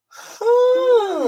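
high, startled huh; a sudden high grunt of surprise; surprised huh. Retold or imitated. Reacting as the viewer.

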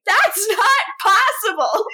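high-pitched mock outraged yell; imitated scream. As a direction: act out an indignant scream without full force. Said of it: "we go off Rebecca screaming".

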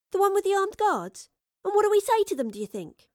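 put-on high incredulous young woman. Astrid to the Professor about armed guards, incredulous. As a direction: question his plan in disbelief.

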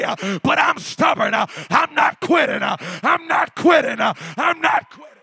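high, loud shouted preaching. Acted. Preacher rallying the congregation never to quit, shouting. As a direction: drive it home, full force.

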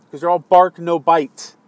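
normal talking voice

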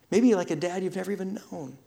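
ordinary talk

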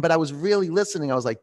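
ordinary talk, nothing marked

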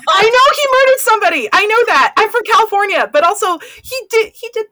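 loud, high, emphatic protest. Real, not acted. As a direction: insist loudly, half laughing. Emphatic protest, higher and louder.

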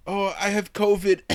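mock a guest calling in sick; mocking excuse. Mock quote of cancelling guest.

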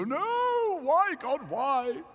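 high wailing no, why God why; mock despair, voice shooting up high; hammed-up lament of English passengers; anguished cry. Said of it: "all the English people go".

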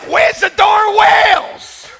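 high, loud, slow impatient laughing shout; exasperated yell. Retold or imitated. Tourist yelling at the whale-watching guide, impatient shout. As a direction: demand the whales, fed up. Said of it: "I'm like".

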